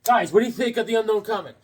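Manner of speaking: normal talking voice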